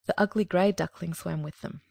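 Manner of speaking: plain reading voice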